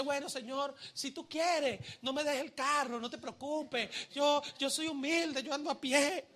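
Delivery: softened, falsely meek plea; mock humility. Bashful believer praying about a car, mock humble. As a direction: play the humble martyr, hammed up.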